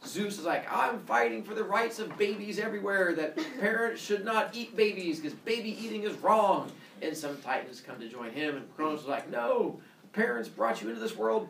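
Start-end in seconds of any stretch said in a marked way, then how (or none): 0.7-6.7 raised-pitch heroic proclamation; rallying speech. Acted. Zeus rallies gods to his side, mock-heroic speech. 9.3-11.5 raised-pitch mock outrage; indignant rebuttal. Kronos answers Zeus's speech, mock indignant.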